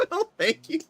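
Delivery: high squeaky character voice. Acted. High put-on voice, laughing.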